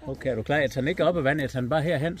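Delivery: usual speaking voice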